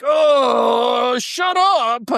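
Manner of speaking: a mocking impression of a dismissive German; dismissive brush-off. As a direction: mimic them waving you away. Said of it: "And they're like".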